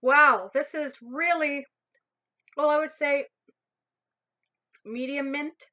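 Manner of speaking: with food in mouth; talking between bites; chewing while talking. Real, not acted. Seen 1: Holds bitten pink treat; lips pressed between phrases, likely chewing.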